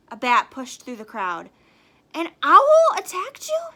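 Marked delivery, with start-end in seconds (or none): 2.1-3.7 very high, loud alarmed question; startled mother. Acted. Mother Bat asks about the owl, high and loud. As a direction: ask it in alarm.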